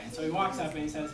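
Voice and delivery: usual speaking voice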